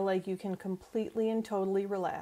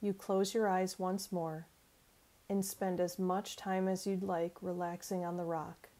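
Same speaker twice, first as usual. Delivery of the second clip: calm meditation voice with long pauses; guided imagery. Acted. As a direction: lead them gently through a relaxation exercise. Leads guided forest imagery.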